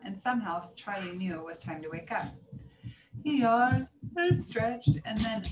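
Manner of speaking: plain reading voice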